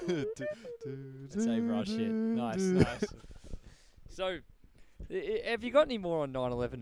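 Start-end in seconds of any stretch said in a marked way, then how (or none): 0.1-3.0 laughing through the words, very slow. Real, not acted. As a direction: squeeze it out between laughs. Laughing after the bit.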